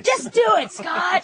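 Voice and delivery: rally the caller with a high shout; high cheering shout. Real, not acted.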